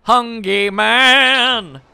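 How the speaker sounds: loud, very drawn-out roar; hammed-up announcement. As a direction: stretch it out loudly like a title call. Big meal announced with relish.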